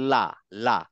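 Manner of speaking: লা with the tongue tip touching just behind the front teeth; light la. Shown as a demonstration. Teacher demonstrating Arabic lam to students with the tongue tip. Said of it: "লেগে আবার সরে যাবে".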